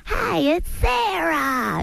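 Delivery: high, very slow prank-call put-on voice; pranking her ex on the phone as Sarah, high and slow; Sarah Silverman impression. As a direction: pitch way up and drag the words out. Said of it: "You can actually do a Sarah impression".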